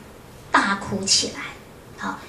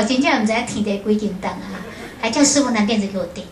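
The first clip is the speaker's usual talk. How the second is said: ordinary delivery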